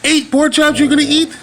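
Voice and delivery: 2 high, loud, incredulous question; 3 act floored by the number of chops; 1 mock astonished. Real, not acted. 4 owner teasing her hungry dog, high and astonished.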